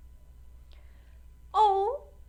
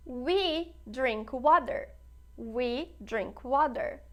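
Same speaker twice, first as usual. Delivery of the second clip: slow, deliberate teacher's demo; slow classroom model reading; slow model sentence; much slower than usual. Shown as a demonstration. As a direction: say it slowly so learners can follow. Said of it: "teacher models sentence twice".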